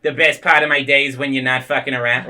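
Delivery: loud, rough mock Boston accent; terrible Boston accent. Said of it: "That's a terrible accent".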